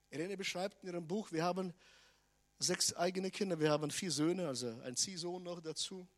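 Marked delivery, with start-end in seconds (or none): none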